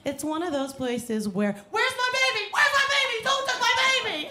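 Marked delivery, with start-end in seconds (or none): 1.7-4.3 frantic mother. Retold or imitated. Imitating frantic mother in store.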